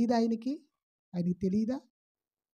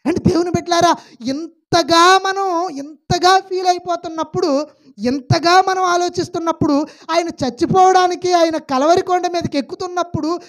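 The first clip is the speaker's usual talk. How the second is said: loud, high preaching voice, pushing each phrase out; full-force preaching; 9 semitones higher, 8 dB louder than usual. Acted. Preacher booming at the congregation in full preaching force. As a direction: preach it hard to the pews.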